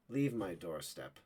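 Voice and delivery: low, soft and slow; a quiet, icy warning; cold dismissal. Acted. Woman at her door turning the soldier away, low and cold.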